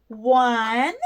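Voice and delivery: normal talking voice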